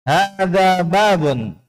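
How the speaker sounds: "Hadza babun" high and very slow, both long a vowels held, final fall; model statement intonation. Shown as a demonstration. Teacher reads for the class to follow, drawn-out statement intonation. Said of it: "Perhatikan panjang pendeknya dan intonasinya".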